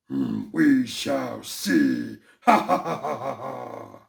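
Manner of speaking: slow, drawn-out villain threat; slow menace into a villain's laugh; menacing cartoon villain.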